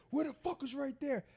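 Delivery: high-pitched mock-incredulous demand; hostile cop retold. Cop doubting where he lives, high mocking challenge. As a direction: fire it back high, as a disbelieving cop.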